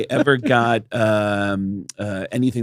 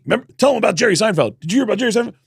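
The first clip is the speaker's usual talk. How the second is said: imitated excited employees; 9 semitones higher than usual.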